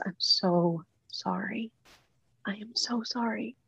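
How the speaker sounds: sobbing apology. Retold or imitated. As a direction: beg forgiveness through tears, fading to a whisper. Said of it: "And I'm sobbing".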